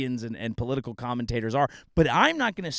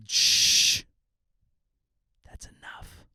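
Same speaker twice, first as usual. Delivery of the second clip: shushing; slower than usual. Retold or imitated. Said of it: "and then say".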